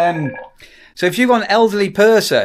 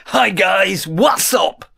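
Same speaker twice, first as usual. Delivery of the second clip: mock YouTuber greeting; 3 semitones higher, much slower than usual. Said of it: "emulate what Americans do".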